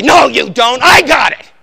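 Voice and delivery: full-force shouted takeover, high and very loud; explosive, high-pitched shout; yelling. Acted. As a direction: yell it like you're wrenching back control. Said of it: "when you yell, "I've got it".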